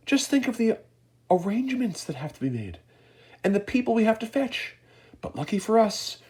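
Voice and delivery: high, brisk eccentric host voice; Mister Wonka eager. A narrator's reading. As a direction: hurry them along cheerfully. Wonka urging them into lift.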